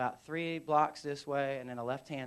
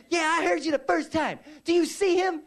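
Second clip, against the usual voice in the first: loud, raised sergeant's voice; high, forceful holler; exasperated shouting back; 12 semitones higher, 9 dB louder than usual. Retold or imitated. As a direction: shout back impatiently across the street. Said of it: "hears him from across the street".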